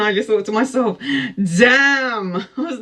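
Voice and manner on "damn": long, drawn-out, higher-pitched damn; awestruck exclamation. Retold or imitated. To herself on seeing him across the way, smitten. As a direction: let an admiring damn stretch out. Struck by his angelic looks.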